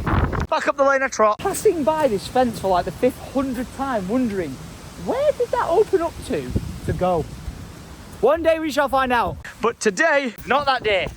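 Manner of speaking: a hint of a cheeky pet narrating; dog's inner voice. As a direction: tell it as the dog wandering the lane. Voicing the dog's inner thoughts.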